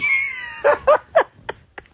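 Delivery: very high, loud squealing laugh; real laughing fit. Real, not acted. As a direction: burst out in high-pitched laughter. Cracking up at own catchphrase.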